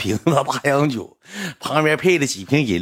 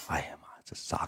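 whisper; 6 semitones lower, 16 dB quieter than usual. Retold or imitated. Woman whispers about the price.

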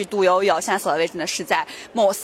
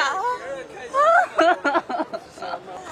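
nervous laugh; 8 semitones higher than usual. Real, not acted. Awkward after interviewee leaves.

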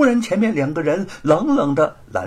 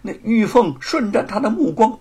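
ordinary delivery

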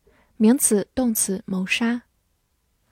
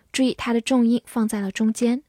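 usual speaking voice

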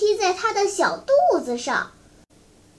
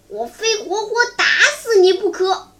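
through gritted teeth, raised pitch; vicious, vengeful threat; 咬牙切齿; 5 semitones higher, 4 dB louder than usual. A narrator's reading. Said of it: "咬牙切齿地说". (咬牙切齿地狠狠说)

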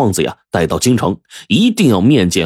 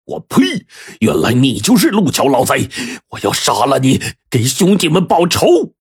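spat-out curse, much higher and raging; furious cursing; 7 semitones higher than usual. A narrator's reading. Soldier curses the shop master who saved him, furious. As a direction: roar it at the man who killed your brothers. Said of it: "指着陆桥就骂".

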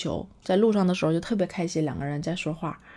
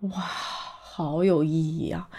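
moved wow; much slower than usual. Real, not acted. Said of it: "我就觉得".

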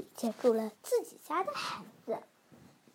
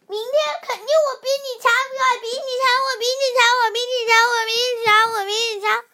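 high, loud, fast repeated taunting chant; boastful taunt; 7 semitones higher, 6 dB louder, much faster than usual. Acted. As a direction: needle the rival over and over. Children quarrel on the bus.

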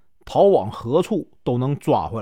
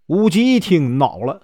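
ordinary delivery, nothing marked

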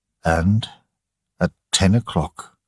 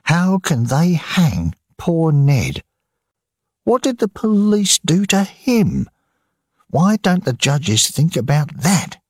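angry; 10 semitones higher than usual. A narrator's reading. Maggie railing against police and judges, angry and high. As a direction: demand answers, voice rising in anger. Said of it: "said Maggie angrily".

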